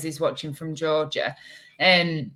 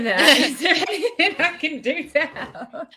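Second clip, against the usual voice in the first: laughing; 8 semitones higher than usual. Real, not acted. Giggles through kind reply.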